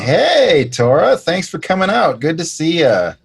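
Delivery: high, breezy greeting as if he owns the boat; acting like the owner. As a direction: greet her like the boss of the riverboat. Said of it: "act like I own the riverboat and say".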